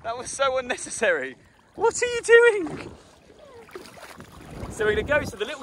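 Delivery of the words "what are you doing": shocked protest. Real, not acted. Splashed, protesting.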